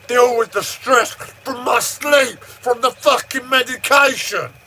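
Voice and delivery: high, strained outburst; real high-pitched venting at breaking point; overwhelmed shouting. Real, not acted. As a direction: cry out in exasperation, pitched right up. Breaking point over sleep and stress.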